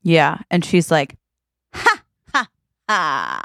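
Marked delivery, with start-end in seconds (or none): 1.7-3.4 gloating laugh. Pretend. Villain child gloating laugh.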